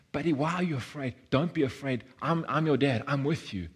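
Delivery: put-on loving dad soothing his boy; tender, caring father. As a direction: comfort a frightened young child. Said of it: "the most tender, caring, loving voice".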